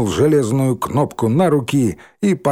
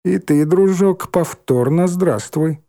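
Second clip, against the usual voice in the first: slow, higher sarcastic greeting; mocking; 7 semitones higher, slower than usual. A narrator's reading. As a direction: draw it out with mock kindness. Mock greeting to Dimka. (медленно и издевательски)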